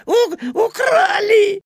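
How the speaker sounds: laughing through the words, squealing; being tickled. Acted. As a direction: gasp and giggle between words. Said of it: "смеясь от щекотки".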